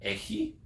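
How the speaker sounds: "Έχει" soft and high, rising like a question; questioning intonation. Shown as a demonstration. Teacher demonstrating a Greek question, questioning intonation. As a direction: lift "Έχει" high to ask it. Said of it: "put a bit of intonation on".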